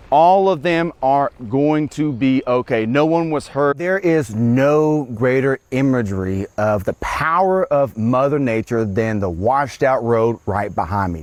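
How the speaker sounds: normal talking voice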